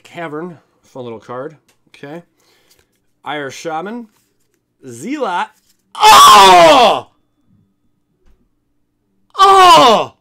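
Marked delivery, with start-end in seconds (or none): 5.9-10.1 real, very high, very loud shout of shock and joy; ecstatic shout. Real, not acted. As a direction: scream it like you just hit the jackpot. Pulls rare Jace card.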